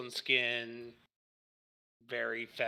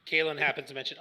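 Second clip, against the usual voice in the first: ordinary delivery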